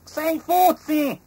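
very high, loud, slow cry; grasshopper's shout. A narrator's reading. Grasshopper recognising Fuzzi, high slow shout. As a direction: call out the name with joy. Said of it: "gridò Cavalletta".

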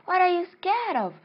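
very high questioning; high-pitched incredulous question; acted bafflement. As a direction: squeal it in mock puzzlement. Acted puzzled reply to scared fish.

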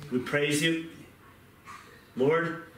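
earnest closing prayer to the Lord. Real, not acted. Pastor closing the sermon in prayer over the congregation, reverent. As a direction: pray it out, reverent and sincere.

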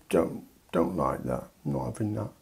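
put-on low, soft, sulky toddler grumble. UK as toddler refusing the parachute, sulky mumble. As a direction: mumble it like a child refusing food.

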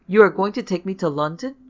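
loud, rising disbelief and dismay; sharp with dismay. A narrator's reading. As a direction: question it in sharp alarm. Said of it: "voice was sharp with dismay".

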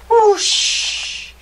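high, drawn-out whoosh; whoosh sound effect. A narrator's reading. Narrator sounds out the mouse's fall, high dramatic whoosh. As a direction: voice the whoosh like rushing air.

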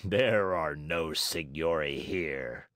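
low, slow ogre voice; big giant's drawn-out rebuff; Fire Eater rebuffing. A narrator's reading. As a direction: refuse heavily, dragging the words.

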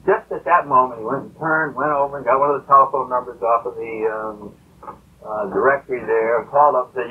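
usual speaking voice

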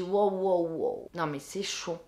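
low, hushed "wow" to oneself; quietly taken aback, under the breath; stunned reaction. Retold or imitated. Witness reacting to the suspicious pair, stunned and hushed.

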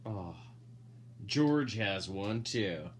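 normal talking voice

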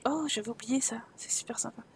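whispered sign-off; soft unvoiced farewell. Real, not acted. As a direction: breathe it out to the viewers. Whispering while playing.

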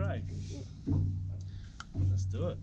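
normal talking voice

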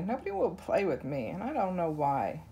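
ordinary delivery, nothing marked

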